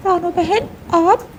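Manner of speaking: high, quickened startled greeting; surprised parrot. Acted. Parrot opens the door to Ranu in the rain, surprised. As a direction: ask in surprise, pitched well up.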